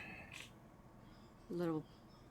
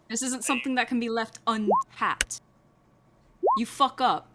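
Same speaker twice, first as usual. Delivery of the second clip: a louder, hard-pressing mobster boss; menacing boss, moderately raised voice; angry NPC; 4 semitones higher, 9 dB louder than usual. Acted. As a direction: lean in and threaten him, a bit louder.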